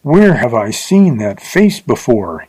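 ordinary delivery, nothing marked

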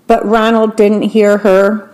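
plain reading voice

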